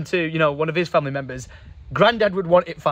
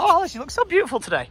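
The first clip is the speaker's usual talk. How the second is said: mock flattery; 11 semitones higher, faster than usual. Teasing Alice on camera.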